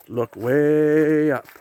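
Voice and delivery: Jolly Green Giant. Retold or imitated. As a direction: stretch "Look way up" out like a big jolly giant calling. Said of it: "Kinda like the Jolly Green Giant".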